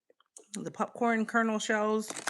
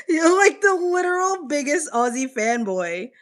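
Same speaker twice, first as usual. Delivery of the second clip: higher, louder, half crying with laughter; laughing tease; 9 semitones higher, 5 dB louder than usual. Real, not acted. Teasing the biggest Aussie fanboy, laughing. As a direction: tease him while cracking up.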